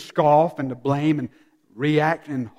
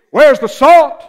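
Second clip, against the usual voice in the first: high, loud ringing call; fiery pulpit exclamation; preacher's cry; 7 semitones higher, 10 dB louder, slower than usual. Acted. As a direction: shout it out to the congregation. Preacher's climactic rhetorical cry.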